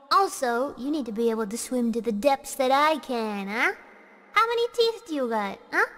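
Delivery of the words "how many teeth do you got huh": pitched-up teasing question; high flirty quiz.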